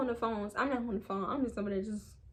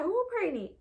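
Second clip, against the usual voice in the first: much higher, shocked question; shocked who; 9 semitones higher than usual. Retold or imitated. Dad hearing the pregnancy news, much higher and shocked. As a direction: squeak out who in disbelief. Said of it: "my dad was like".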